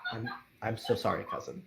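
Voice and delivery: soft, low, halting sorry; timid apology. Acted. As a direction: falter on the first word, then apologize quietly. Nephew apologizes to cousin.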